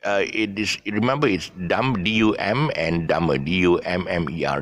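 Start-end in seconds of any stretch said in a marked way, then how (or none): none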